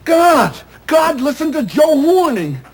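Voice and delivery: indignant cry, slow on the name; outraged outcry. Acted. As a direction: throw the name up to heaven in disbelief. Outraged rant about God listening.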